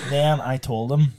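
ordinary talk

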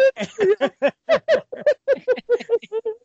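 helpless high laugh; cracking up hard; burst of laughter. Real, not acted. Host breaks up in laughter.

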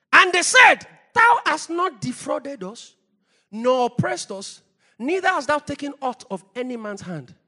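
loud, high, measured proclamation; declaimed scripture. Acted. Preacher quoting the people's answer to Samuel, loud and declamatory. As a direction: open at a shout, then ease slightly.

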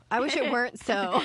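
hammed-up regret, pushed out for a laugh; playfully emphatic. Repeats the line with playful emphasis.

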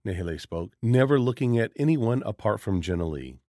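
ordinary delivery

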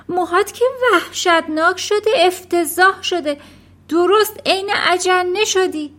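high, loud horrified scolding; appalled wife's shrieked tirade; shrieking. A narrator's reading. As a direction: screech at your husband in disbelief. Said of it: "مادر جیغ زنان گفت".

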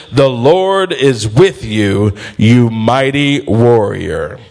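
lightly voiced angel, stretching the words out; angel's proclamation. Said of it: "the angel of the Lord appeared to Gideon. He said".

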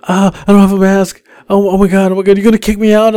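high, rushed plea; mock panicked. Maskless girl pleads with driver not to kick her out, mock panicked. Passenger fears being kicked out over mask.

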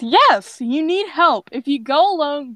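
loud, high-pitched overruling; firm insistence. A narrator's reading. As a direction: argue down a stubborn kid. Ember argues with stubborn Ash.

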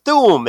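booming thoom of a blast; mock explosion boom; explosion sound. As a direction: belt out the explosion noise. Fireball explosion sound effect.

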